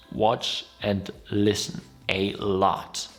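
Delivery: normal talking voice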